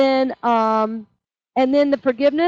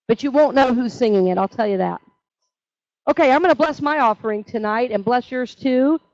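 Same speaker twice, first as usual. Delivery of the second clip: ordinary talk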